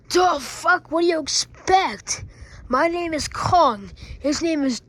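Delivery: high, loud outraged declaring; indignant shout. Acted. Kong the toy dad announces his son, loud and outraged. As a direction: bellow it like an offended dad.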